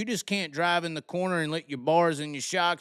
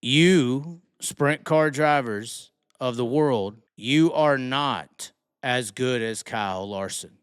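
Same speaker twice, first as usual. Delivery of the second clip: slow, deliberate, every word spelled out for Southern-accent skeptics; slow, emphatic, lower; slow and clear; 4 semitones lower, slower than usual. Shown as a demonstration. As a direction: lay it out slowly so nobody misses it. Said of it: "Let me say it for everyone to understand".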